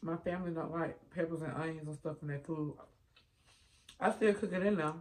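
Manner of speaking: speaking between bites; chewing while talking. Real, not acted. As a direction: mouth partly full. Seen 0: Lips pursed and working as if chewing; spoon stirs beans, none eaten.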